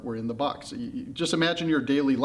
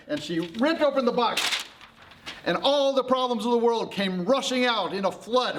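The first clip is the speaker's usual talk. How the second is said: high, excited storytelling; dramatic climax; 9 semitones higher than usual. A narrator's reading. Narrator as the problems burst from the box, excitedly. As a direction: raise the pitch as disaster breaks loose.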